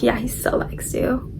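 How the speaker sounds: hushed singsong tease; teasing murmur. Retold or imitated. Friends' teasing retold in chorus.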